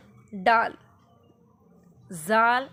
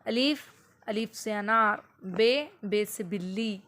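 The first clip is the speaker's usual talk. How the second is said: slow repeated letter chant; teacher repeating alphabet to a child; slow teaching drill. Shown as a demonstration. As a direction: say it again and again for the child. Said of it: "हम बार बार उसके सामने बोलेंगे".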